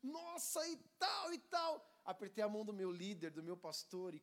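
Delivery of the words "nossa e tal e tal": high, rising to a shout of joy; excited exclamation. Retold or imitated. Young man gushing about Jesus after retreat. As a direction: gush like a fired-up youth. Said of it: "E eu falei".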